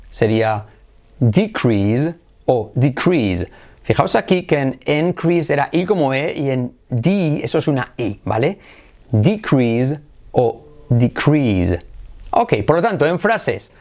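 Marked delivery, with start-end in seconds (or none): 1.2-3.5 DEcrease then deCREASE, slowly; contrasted syllable stress. Shown as a demonstration. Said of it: "si acentuamos la primera sílaba o la segunda". 9.1-11.8 DEcrease then deCREASE, very slowly; contrasted syllable stress. Shown as a demonstration. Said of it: "si acentuamos la primera sílaba o la segunda".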